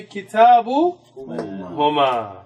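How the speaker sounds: "Kitabu huma" drawn out slowly; slow Arabic demo. Shown as a demonstration. Teacher showing the dual pronoun slowly to students. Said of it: "you would say".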